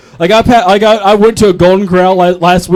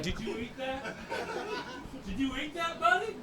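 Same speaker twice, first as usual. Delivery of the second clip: hushed whisper, rising higher on the repeat; alarmed whisper; 10 semitones higher, 26 dB quieter than usual. Retold or imitated. Dad finding his son ate the meth, stunned whisper. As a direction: whisper the question, more urgent the second time.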